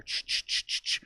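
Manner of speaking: rhythmic unvoiced "ch" bursts; quick shushing shaker sounds; imitated drink-mixing noise. Comic miming a busy bartender, imitated shaker noise. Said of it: "she's fucking mixing drinks back there".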